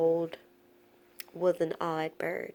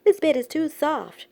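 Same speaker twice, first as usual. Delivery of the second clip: much higher and louder, a fussy protest; high complaining voice; 9 semitones higher, 6 dB louder than usual. A narrator's reading. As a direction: squeak out the complaint. Tacky complains about second bed.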